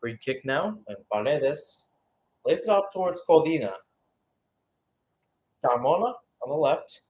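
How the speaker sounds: usual speaking voice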